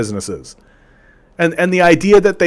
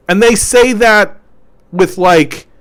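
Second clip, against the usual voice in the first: normal talking voice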